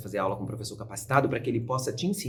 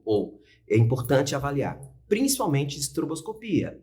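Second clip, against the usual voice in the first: ordinary talk, nothing marked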